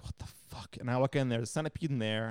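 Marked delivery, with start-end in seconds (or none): 0.0-0.8 breathless whispered what the fuck; whispered disbelief. Retold or imitated. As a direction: whisper it in shock at the cat. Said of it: "I'm just like".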